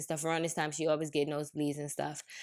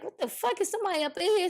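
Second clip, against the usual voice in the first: high, startled retelling of a midnight scare; startled alarm; 13 semitones higher, faster than usual. Retold or imitated. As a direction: jump up at the noise, alarmed. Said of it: "And I was like".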